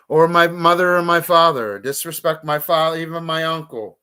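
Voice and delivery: fired up, daring anyone to try; heated shout building through the list; furious outburst. Real, not acted. Furious rant about disrespect.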